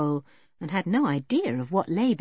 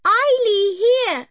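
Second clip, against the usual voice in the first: put-on high, loud and simple, childlike questions; a shy mountain girl asking in few words; wild girl's voice; 7 dB louder, slower than usual. Eileen calls up from below.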